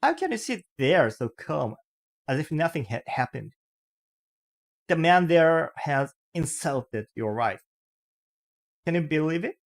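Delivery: insulted wife upbraids her calm husband; upset wife. Acted. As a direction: demand he defend her. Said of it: "clearly upset".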